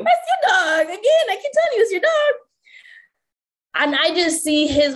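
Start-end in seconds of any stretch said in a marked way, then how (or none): 0.0-2.3 high-pitched exasperated scolding. Retold or imitated. Scolding partner about his dog.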